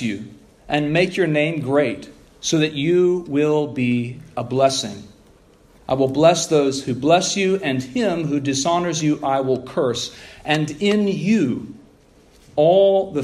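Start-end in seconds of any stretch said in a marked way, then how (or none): none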